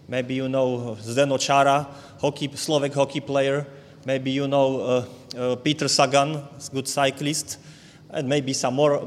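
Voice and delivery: ordinary talk, nothing marked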